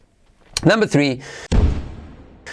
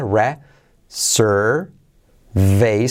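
each syllable isolated by a pause; much slower than usual. Shown as a demonstration. Teacher sounding out reservation for learners, syllable by syllable. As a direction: break it into slow, separate chunks. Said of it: "tenemos la letra clave R".

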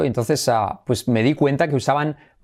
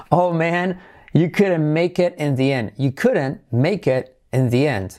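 raised "Oh man", then slow, drawn-out words; slow regretful model; slower than usual. Shown as a demonstration. Teacher repeating the example for learners, slow and regretful. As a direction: spell out the lament slowly for learners.

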